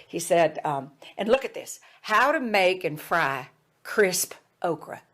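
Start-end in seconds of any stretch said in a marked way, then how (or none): 1.2-5.0 put-on Jim Hickson, Southern accent. Student reads out a recipe headline, Southern accent. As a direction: read it aloud with a stretched Southern accent. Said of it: "he had this Southern accent".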